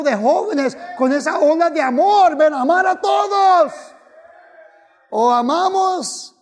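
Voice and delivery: building from loud preaching to a laughing shout; fiery preacher climbing to a shout; preaching at full force. Real, not acted.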